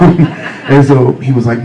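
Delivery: normal talking voice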